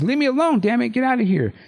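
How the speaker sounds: high shrieking protest; mock shriek. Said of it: "and she shrieks".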